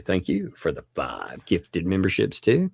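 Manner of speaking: ordinary talk, nothing marked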